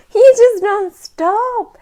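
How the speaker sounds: high, loud, drawn-out mock wail; mock exasperation. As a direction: stretch it out in overwhelmed exasperation. Mock exasperation at nonstop demands.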